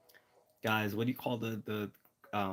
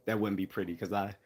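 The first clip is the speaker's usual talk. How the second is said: ordinary talk, nothing marked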